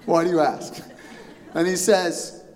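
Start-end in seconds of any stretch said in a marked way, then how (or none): 0.1-0.8 mock-tense insistence on being okay; twitching, feigned calm. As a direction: pretend to be fine while twitching.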